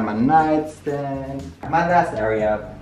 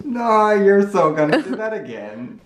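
high, laughing tease; teasing laugh; 9 semitones higher than usual. Real, not acted. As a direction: taunt them through a laugh. Teasing insistence.

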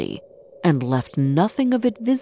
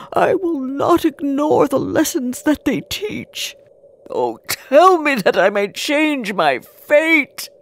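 high, fervent begging; desperate pleading; 6 semitones higher, 3 dB louder than usual. A narrator's reading. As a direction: plead for your fate at full pitch. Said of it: "Scrooge cried".

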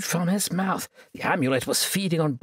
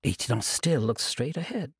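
plain reading voice

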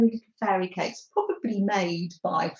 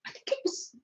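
whispering, higher and much softer; whispered to herself; 13 semitones higher, 10 dB quieter, faster than usual. Real, not acted. Host recalling a muffin recipe in a whisper. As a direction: murmur it while trying to recall.

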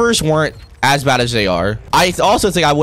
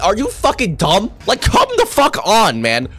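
real frustrated rant, higher; exasperated outburst; 6 semitones higher than usual. Real, not acted. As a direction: pitch jumps high in disbelief. Ranting at students retaking classes.

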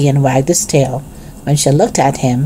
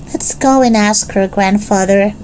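put-on higher-pitched eager child figure; a hint of little Benny's higher voice; lightly voiced young Benny; 5 semitones higher than usual.

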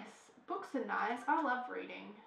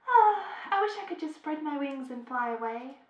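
louder, higher, yearning sigh; wistful character voice; 4 semitones higher, 8 dB louder than usual. Acted. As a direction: sigh it like a trapped bird. Reads a character's wistful line.

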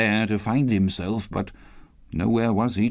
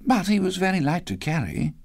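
mother resuming; 9 semitones higher than usual. A narrator's reading. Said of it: "she resumed, intent upon her work".

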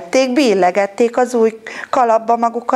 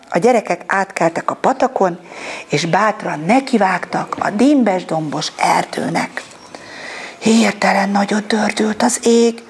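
ordinary delivery

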